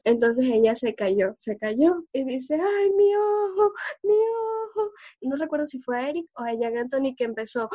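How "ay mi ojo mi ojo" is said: put-on much higher, slow, whiny little-girl wail; little sister's mock crying over her sore eye; whimpering child.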